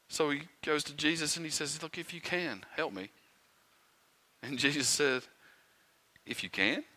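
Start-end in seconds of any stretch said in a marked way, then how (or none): 1.8-3.1 softly voiced, doubting request; hesitant plea. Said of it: "he's hesitant here". 6.3-6.9 soft, questioning challenge with a rising end; incredulous echo. Acted. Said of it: "That's how you're coming at me with this?".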